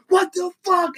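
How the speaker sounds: high-pitched, indignant mock yelp; mock outraged cry. Mimicking Drayton reacting to the taunting song, mock outrage. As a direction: squeal it in hammed-up disbelief.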